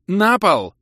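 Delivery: very high, loud barked command; shouted order. A narrator's reading. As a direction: yell it so no one can disobey. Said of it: "выкрикнула Либи". (крик-приказ)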